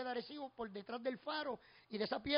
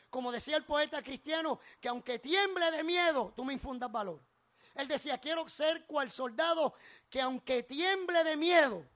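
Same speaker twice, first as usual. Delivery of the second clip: forceful preaching, louder on the key phrases; 3 semitones higher, 7 dB louder than usual. Real, not acted.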